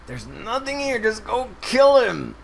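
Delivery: high, loud exasperated urging; frustrated urging. Real, not acted. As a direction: yell at him to finish it. Yelling at the player to finish.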